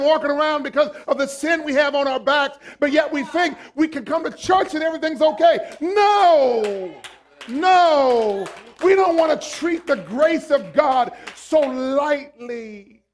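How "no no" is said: loud, firm double no. Real, not acted. Preacher to congregation, a loud firm refusal. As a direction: shut the idea down hard. Preacher rejecting false piety.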